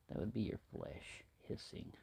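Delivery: soft unvoiced whisper; mock sinister whisper; menacing whisper. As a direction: whisper it like a threat.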